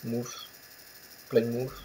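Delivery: "Plymouth" in an English accent, ending on a soft "mouth". Shown as a demonstration. Gamer practising the name "Plymouth" for viewers, English accent. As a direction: try out "Mouth", then "Plymouth" with English sounds. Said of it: "J'ai pris mon accent anglais".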